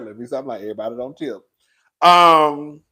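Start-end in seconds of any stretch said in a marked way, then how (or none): 0.0-0.6 laughing self-correction; laughing at herself. Real, not acted. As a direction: chuckle through it.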